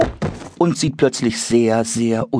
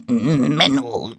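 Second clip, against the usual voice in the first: schimpfend; 6 semitones higher than usual. Acted. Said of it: "schimpft das Monster".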